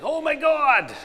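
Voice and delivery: high, loud mock wail; exasperated outcry. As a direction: cry out in comic despair. Husband overwhelmed by wife's sudden flip.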